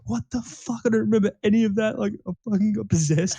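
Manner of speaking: high, upset mock wail of a freaked-out mate; imitating bawling friend. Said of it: "he was bawling his eyes out".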